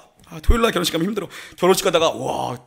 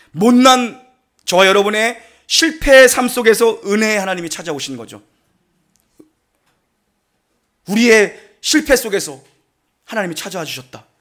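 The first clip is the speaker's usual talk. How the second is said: solemn preaching. Acted. Slow solemn preaching climax.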